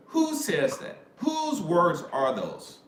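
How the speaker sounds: high-pitched demanding question; incredulous challenge. Real, not acted. Challenging the bros watching to answer, incredulous. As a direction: press the question, pitch leaping up.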